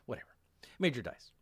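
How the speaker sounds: ordinary delivery, nothing marked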